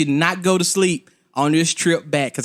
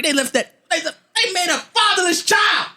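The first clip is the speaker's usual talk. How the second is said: outraged; 14 semitones higher than usual. Real, not acted. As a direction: cry out in mock outrage.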